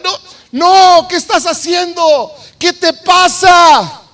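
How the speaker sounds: high, drawn-out cry of disbelief; incredulous yell, dragging the last words; outraged protest. Acted. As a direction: protest loudly as an appalled comrade. Acting David's men protesting the decision.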